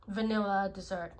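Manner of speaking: ordinary talk, nothing marked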